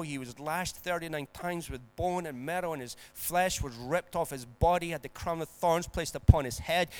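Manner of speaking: ordinary talk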